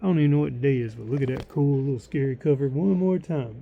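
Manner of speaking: ordinary delivery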